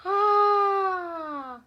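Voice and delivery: usual speaking voice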